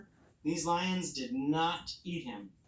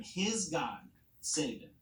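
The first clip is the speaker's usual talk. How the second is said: ordinary delivery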